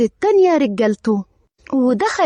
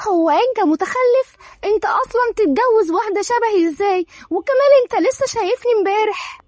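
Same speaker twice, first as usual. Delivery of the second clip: much higher, indignant disbelief; stunned outrage, getting faster at the end; shocked; 8 semitones higher than usual. A narrator's reading. Dareen reacting to Eyad's marriage demand, shocked. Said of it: "دارين بصدمة".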